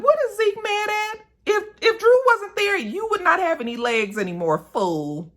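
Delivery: how dare he be mad; exasperated disbelief. Retold or imitated. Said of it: "And I was just like".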